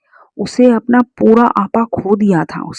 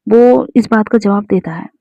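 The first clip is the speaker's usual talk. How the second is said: ordinary delivery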